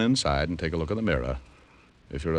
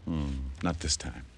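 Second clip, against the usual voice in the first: low teasing reply; 5 semitones lower, 9 dB quieter, slower than usual. Acted. Flirting back in the tent.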